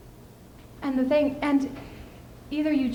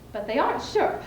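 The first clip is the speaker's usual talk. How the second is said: normal talking voice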